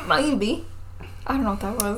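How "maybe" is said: put-on Australian accent. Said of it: "your Australian accent?".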